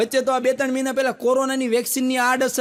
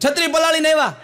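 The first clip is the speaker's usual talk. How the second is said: higher, louder mock scolding; mock angry wife; 6 semitones higher, 5 dB louder than usual. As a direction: shout it like the fuming wife. Wife yelling at husband. (ગુસ્સે પત્નીની જેમ મોટેથી બોલો)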